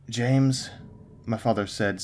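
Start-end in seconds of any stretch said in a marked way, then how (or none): 0.1-0.7 stern. A narrator's reading. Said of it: "sounding stern".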